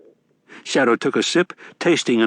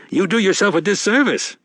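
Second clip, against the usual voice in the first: a touch higher, genial con man; sly old grifter, a bit higher; lightly voiced Wednesday; 10 semitones higher than usual. A narrator's reading.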